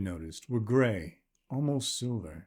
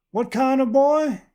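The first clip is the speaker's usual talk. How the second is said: much higher and louder, a well-used voice; worn old woman calling back; 10 dB louder than usual. Acted. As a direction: call back from afar like a tough pioneer wife. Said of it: "a shrill voice called back".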